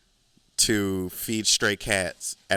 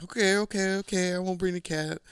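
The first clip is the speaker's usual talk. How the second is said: high, squeaky mock-meek okay; 11 semitones higher than usual. Scolded woman squeaks a meek apology. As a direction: cower and promise in a tiny high voice. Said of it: "the lady was like".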